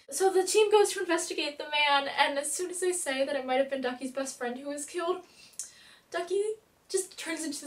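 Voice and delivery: ordinary talk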